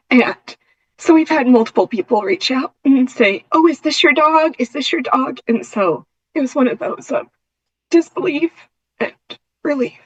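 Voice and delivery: fighting back tears while telling it; intermittent tremor. Real, not acted. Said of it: "sorry, I get emotional".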